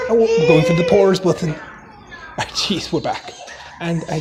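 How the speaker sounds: jokey put-on Irish Jamaican accent; comic commentary in an Irish-Jamaican mash-up accent. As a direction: narrate in a mock Irish brogue with Jamaican slips. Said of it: "narrate it for her in my Irish Jamaican accent".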